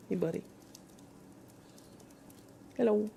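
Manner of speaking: ordinary delivery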